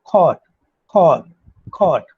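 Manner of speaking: cot said with lips slightly rounded, repeated. Shown as a demonstration. Teacher drills the rounded o in cot. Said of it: "The lips slightly rounding".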